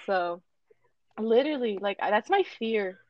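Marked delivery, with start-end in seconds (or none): none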